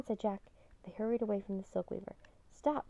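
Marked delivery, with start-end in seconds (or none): 2.5-2.9 mild shout, pitched high; understated narrated shout. A narrator's reading. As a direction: call out a sharp command to halt. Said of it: "someone shouted".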